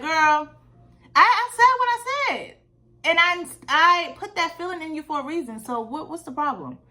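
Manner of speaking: a high, loud, sassy God talking down to her; God telling her off like a sassy auntie; God talking back. Retold or imitated. God answers her in the story.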